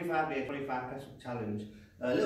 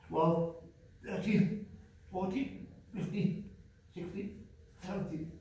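counting reps mid push-up; straining through each press up; doing push ups; 2 semitones higher, slower than usual. Real, not acted. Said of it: "So we're gonna do twenty-five press ups".

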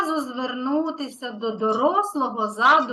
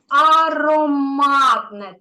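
ароматне drawn out slowly and a bit louder; slow hint; 6 dB louder, much slower than usual. Shown as a demonstration. Teacher feeding the answer to a child, slow and louder.

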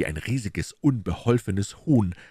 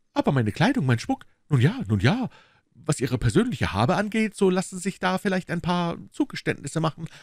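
a smooth, higher-pitched agent, insisting; 8 semitones higher than usual. Acted. Agent insisting to the lady of the house, higher voice. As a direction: play the pushy agent, a notch higher.

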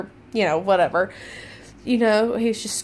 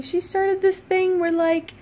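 usual speaking voice

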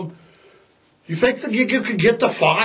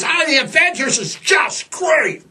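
gushing fan; 9 semitones higher than usual. Retold or imitated. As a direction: rave like a thrilled sixties fan.

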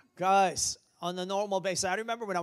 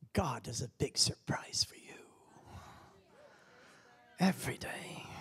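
soft whispered promise; hushed preaching; 4 dB quieter than usual. Real, not acted. Preacher whispering to the congregation.

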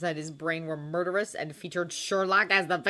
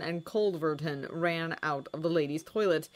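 usual speaking voice

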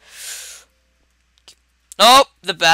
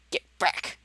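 urgent whisper; 11 dB quieter than usual. Real, not acted. Whispered command to bat.

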